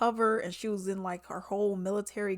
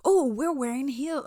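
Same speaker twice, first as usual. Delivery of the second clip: mock amazement; 5 semitones higher, 5 dB louder than usual. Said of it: "I'm like".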